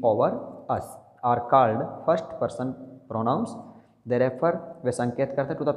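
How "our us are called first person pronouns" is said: slow dictation. Shown as a demonstration. Dictating the definition slowly.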